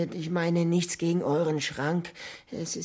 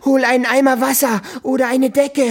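higher, louder frantic cries; panicked alarm; 6 semitones higher, 9 dB louder than usual. Acted. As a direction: panic over a deadly danger. Stranger panics at candle.

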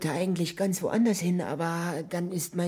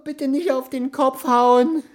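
higher, whimpering plea; frightened pleading; 8 semitones higher, 3 dB louder, slower than usual. Acted. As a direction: cower and beg not to be hit. Creature begs not to be hit.

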